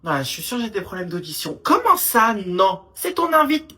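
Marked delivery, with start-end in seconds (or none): 1.6-3.7 indignant mother. Acted. Mother indignant at her son.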